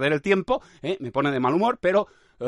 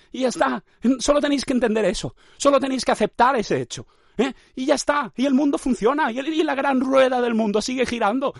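high-pitched, insistent rant; hammed-up, high-voiced exasperation; mock exasperation; 8 semitones higher than usual. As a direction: plead your simple point in a raised pitch.

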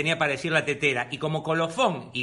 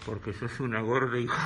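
spirit voice; 8 semitones lower, 6 dB quieter than usual. Retold or imitated. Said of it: "¿Qué contestaba?".

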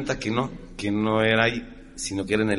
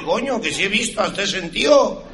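higher Spaniard exclaiming; Spanish accent; 8 semitones higher, 3 dB louder than usual. Retold or imitated. Second Spaniard answering his friend, Spanish accent. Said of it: "Y dice".

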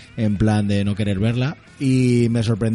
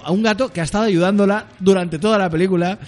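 indignant; 7 semitones higher than usual. Real, not acted. Indignant rant about the cat.

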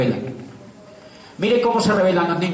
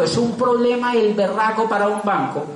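ordinary delivery

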